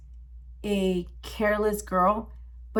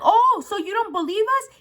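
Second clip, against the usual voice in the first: high, challenging comeback; indignant retort; 11 semitones higher than usual. Retold or imitated. Teller to the disbelieving wife, indignant retort. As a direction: throw it back in acted disbelief. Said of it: "So I was like".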